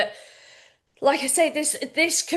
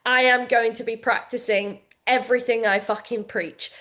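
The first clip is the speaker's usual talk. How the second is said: ordinary delivery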